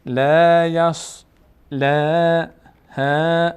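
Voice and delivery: "لا" and "ها" with long, light, unrounded vowels; thin light vowels. Shown as a demonstration. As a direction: chant "لا" and "ها" slowly, keeping them thin. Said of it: "اینا هیچ کدوم پر نمیشن".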